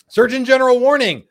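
loud, high announcer call; mock official announcement. Host announces a parody label to viewers, mock official announcement. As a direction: proclaim it like an official alert.

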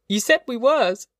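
put-on high, indignant girl's voice; crying out accusingly. A narrator's reading. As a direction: throw it at him in high protest. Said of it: "she cried".

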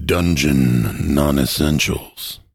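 low, slow gravelly movie trailer voice. Shown as a demonstration. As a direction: growl it like a trailer narrator. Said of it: "Do that in the gravelly voice".

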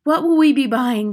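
high, loud eager question; eager cry. Acted. Witches crying out a question to their leader, eager. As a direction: cry out, desperate to know. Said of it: "they cried".

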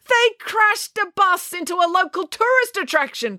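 high outraged cry; Mister Lang cries out at Loretta. A narrator's reading. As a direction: cry out in disbelief. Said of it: "cried Mister Lang".

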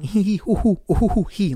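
high mock giggle of an elderly couple; old people giggles. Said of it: "doing old people giggles".

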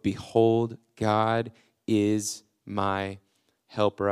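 God is my helper stretched out very slowly, with a pause before helper; slow declaration. Acted. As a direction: drive the truth home, slowly. Repeats the key verse.

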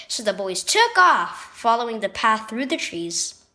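plain reading voice

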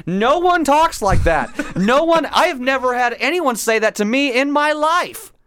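exasperated rant. Real, not acted. Exasperated rant about unnatural film dialogue.

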